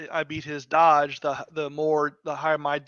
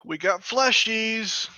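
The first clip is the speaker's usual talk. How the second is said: high, drawn-out announcement; hammed-up, stretched-out cry; mock gleeful call; 6 semitones higher, slower than usual. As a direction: call it out slowly, pitched up. Player calling out in mock monster talk.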